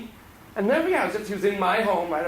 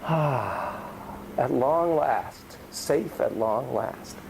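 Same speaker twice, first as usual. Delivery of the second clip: very slow, lower, sighing relief; relieved sigh; 5 semitones lower, much slower than usual. Retold or imitated. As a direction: breathe out at last. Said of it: "she's like this".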